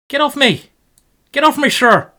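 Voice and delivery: high, loud cry of alarm; panicked shout. Real, not acted. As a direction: yell it as the pieces close in. Shouting at attacking chess pieces.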